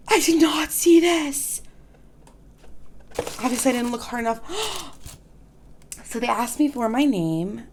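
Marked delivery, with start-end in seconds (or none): 0.1-1.6 sharp gasp, then a loud high cry; gasp, then blurt it out; surprised. Real, not acted. Finding a hidden insert in the box, surprised gasp.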